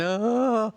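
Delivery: high, loud, rising wail; mock a grown man screaming like a little girl; drawn-out whimpering scream, imitating a frightened kid; imitated girlish scream. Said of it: "literally screaming like a little girl".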